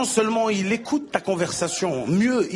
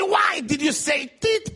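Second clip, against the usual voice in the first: stern interrogation; 7 semitones higher, slower than usual. Acted. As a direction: drag out each word like an interrogator. Guard interrogating, slow.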